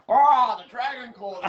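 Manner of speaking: high mimicked cry, loud on the "Oh", breaking into laughter; mock cry. Rider retells his mate's in-joke over helmet comms, mock cry. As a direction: imitate a mate calling out, high and laughing.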